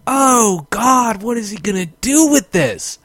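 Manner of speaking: hammed-up horror at the screen; alarmed. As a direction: play up the fright of watching. Retelling the viewers' alarm.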